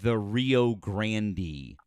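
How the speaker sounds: Grande stretched into GRAN-dee; slowly mock the two-syllable Grande, ending in ee; drawn-out Rio GRAN-dee; quoted mispronunciation. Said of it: "no one calls it".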